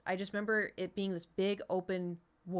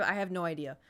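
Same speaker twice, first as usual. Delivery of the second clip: ordinary talk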